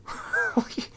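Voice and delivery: short, very high giggle; real laugh. Real, not acted. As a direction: giggle helplessly at your own joke. Laughs at own exploding joke.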